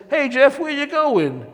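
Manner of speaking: high, loud call after someone leaving; calling out to Jeff as he grabs his bags. Retold or imitated. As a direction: shout after Jeff as he runs.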